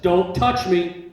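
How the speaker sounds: loud, slow warning with weight on touch; firm warning. Retold or imitated. Telling the sheriffs not to touch him, loud warning. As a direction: hold them off, loud and firm.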